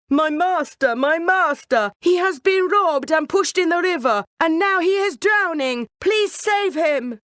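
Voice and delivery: high, loud, frantic yowl for help; yowling shout. Acted. Cat pretending his master is drowning, frantic shout. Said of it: "He yowled and shouted at the top of his voice".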